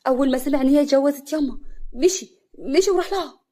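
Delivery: loud, urgent report rising in pitch; agitated. Acted. Brother telling mother Hassan ran to Afaf's, agitated. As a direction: blurt out the alarming news, drawing out the end.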